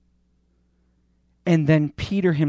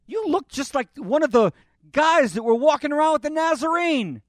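high, loud pointed accusation; suspicious bystander; 11 semitones higher, 3 dB louder than usual. Acted. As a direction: bystander fingering Peter, pitched high and loud. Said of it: "Somebody else says".

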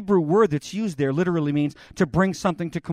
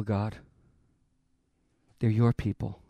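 usual speaking voice

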